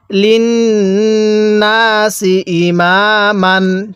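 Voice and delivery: drawn-out chanted recitation; slow Quran recitation. Shown as a demonstration. Teacher reciting slowly for students to repeat. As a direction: recite slowly, stretching each vowel. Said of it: "কিভাবে পড়ব?".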